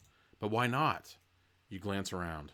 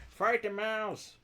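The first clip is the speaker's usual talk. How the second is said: high, slow silly voice; mock rallying cry; 11 semitones higher, much slower than usual. Player jokingly urges a fight, high silly voice. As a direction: squeal it out, drawn out.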